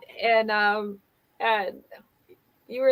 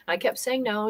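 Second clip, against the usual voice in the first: ordinary delivery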